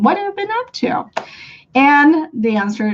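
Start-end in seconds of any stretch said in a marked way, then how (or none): 0.0-1.1 "What have you been up to" quick and high-pitched; lilting, rapid, conversational question; added intonation. Shown as a demonstration. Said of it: "you add some intonation".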